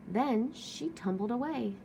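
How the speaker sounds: usual speaking voice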